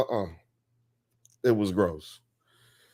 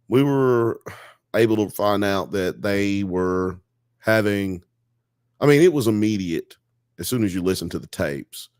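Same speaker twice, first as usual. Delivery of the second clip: ordinary talk, nothing marked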